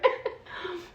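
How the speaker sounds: unvoiced breathy chuckle; short airy laugh at herself. Real, not acted. Laughs at holding things out.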